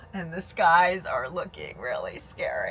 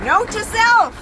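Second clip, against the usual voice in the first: exasperated outburst; 13 semitones higher, 12 dB louder than usual. Real, not acted. After a night of storms.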